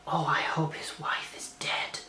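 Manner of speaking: hushed, slow, naughty whisper; a cheeky aside breathed under the breath; sly whisper. Retold or imitated. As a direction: whisper it like a wicked secret. Tanya's line, whispered aside.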